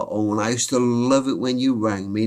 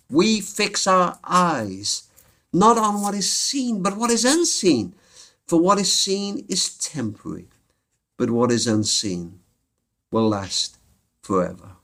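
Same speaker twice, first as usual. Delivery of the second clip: solemn preaching; 4 semitones higher than usual. Acted. Closes with scripture, preaching.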